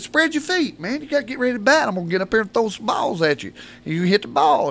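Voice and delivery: high-pitched instructions to the batter; coach calling. Retold or imitated. Coach tells the boy to get ready, calling high.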